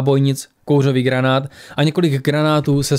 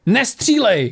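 mild cry out; 8 semitones higher, slower than usual. A narrator's reading. Ari tries to stop the gunman, urgent cry. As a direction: cry out to stop it, pitch leaping. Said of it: "křikl Ari".

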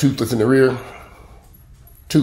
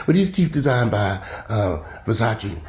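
normal talking voice

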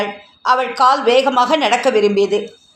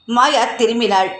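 ordinary delivery

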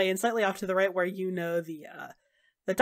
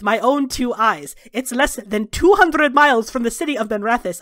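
loud, heated plea with rising pitch; urgent, forceful demand; 4 semitones higher, 7 dB louder than usual. Acted. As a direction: press the danger home, louder as it builds. Zevran slams wrist, argues urgently.

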